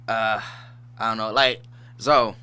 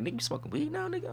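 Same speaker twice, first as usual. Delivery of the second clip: mock brother; 9 dB quieter, faster than usual. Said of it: "my brother ... like".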